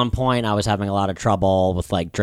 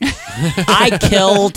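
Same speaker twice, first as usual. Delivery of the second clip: high, loud, very slow proud claim; drawn-out brag; 10 semitones higher, 5 dB louder, much slower than usual. Real, not acted. Drunk set story, stretched proud high boast. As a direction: stretch it out triumphantly.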